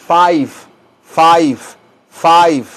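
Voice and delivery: five with a long "ai" and a loud final v; five said clearly. Shown as a demonstration. Teacher showing students how five rhymes with I've. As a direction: stress the "ai" in five. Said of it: "Rima con el número cinco".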